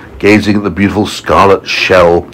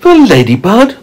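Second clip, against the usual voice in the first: put-on higher surprised boy voice; James surprised; 11 semitones higher, 4 dB louder than usual. A narrator's reading. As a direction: ask it as the puzzled boy. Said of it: "said James".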